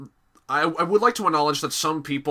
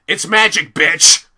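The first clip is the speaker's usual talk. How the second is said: mock shout; 2 semitones higher, 10 dB louder than usual. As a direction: bark it out as a cheeky comeback. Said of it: "everyone's gonna say".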